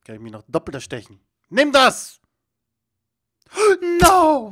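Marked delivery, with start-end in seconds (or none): 1.5-2.2 loud, high attack shout; triumphant throwing yell; battle cry. Real, not acted. Battle cry while throwing at pirate. 3.5-4.5 gasp, then a high loud cry; shocked, high-pitched outburst; alarmed gasp. Real, not acted. Near miss in the game.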